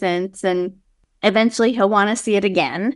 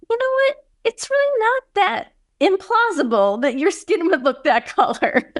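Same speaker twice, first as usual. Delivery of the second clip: high mock realization; 11 semitones higher than usual. Retelling own musing realization.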